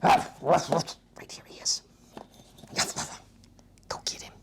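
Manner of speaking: slow hushed whisper; playful whisper. Acted. Therapist teasing child with dinosaur, slow whisper. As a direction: whisper slowly to tease.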